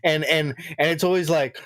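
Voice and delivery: ordinary talk, nothing marked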